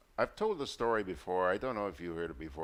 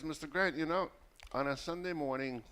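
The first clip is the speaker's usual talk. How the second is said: higher-pitched country caller imitation; put-on rural woman's voice, raised pitch; rural vendor woman; 7 semitones higher than usual. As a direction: imitate a market vendor speaking in dialect. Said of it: "somebody from the rural districts".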